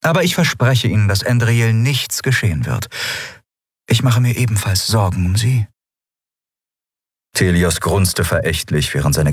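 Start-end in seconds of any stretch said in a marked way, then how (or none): 0.0-5.7 a slightly higher, composed empress voice; calm, polite ruler holding back a secret; lightly voiced empress. A narrator's reading. Empress reassuring the admiral, lightly higher voice.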